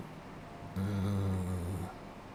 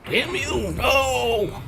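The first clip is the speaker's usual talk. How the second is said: high, loud mock anguish; dramatic no; 8 dB louder, much slower than usual. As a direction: cry out in hammy despair. Mock dramatic death cry.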